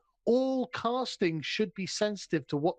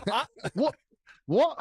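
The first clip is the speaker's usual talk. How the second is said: baffled; 6 semitones higher, 3 dB louder than usual. Acted. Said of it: "You'd be like".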